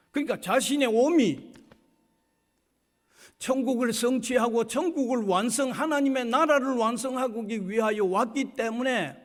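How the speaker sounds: high, insistent pulpit voice; preacher pressing toward the climax; emphatic preaching. Real, not acted. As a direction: build to a loud proclamation.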